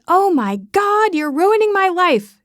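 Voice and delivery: high, loud hammed-up teen outburst; mock dramatic shout. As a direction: fake a teenage tantrum, loud and high. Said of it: "dramatically shout".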